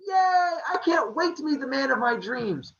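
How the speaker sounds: put-on high, girlish squeal of delight; gushing mock excitement. Aphrodite hears she will get married, high excited gushing. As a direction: squeal it high, dreamily thrilled.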